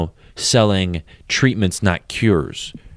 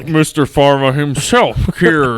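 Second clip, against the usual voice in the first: higher, hammed-up bigwig; Big Pharma boss; 4 semitones higher than usual. Mister Pharma himself calling the podcaster, hammed-up boss voice. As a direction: put on a self-important pharma boss voice. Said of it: "this is president of Big Pharma".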